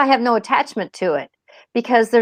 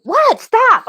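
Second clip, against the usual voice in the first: a sudden much higher, louder "What? Stop."; startled; 10 semitones higher, 4 dB louder than usual. Retold or imitated. Driver startled by a spirit's warning, high surprised yelp. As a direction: caught off guard, jump up in pitch.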